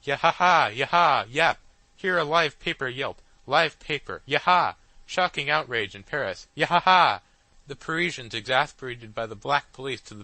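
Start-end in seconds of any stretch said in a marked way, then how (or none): 0.0-7.2 loud, higher jeering yelps; loud yelping news machine; shrill yelping. A narrator's reading. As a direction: yelp it like a live paper. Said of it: "in a shrill voice".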